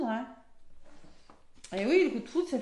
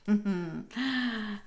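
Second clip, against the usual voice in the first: knowing chuckle; 4 dB quieter than usual. Real, not acted. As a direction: chuckle briefly to yourself. Amused that Cupid has struck. (petit rire entendu)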